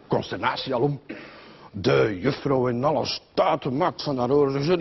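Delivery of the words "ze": ordinary delivery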